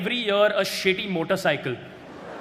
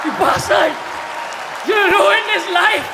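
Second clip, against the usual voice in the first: mock outrage; 9 semitones higher, 4 dB louder than usual. Repeated mock outrage, higher.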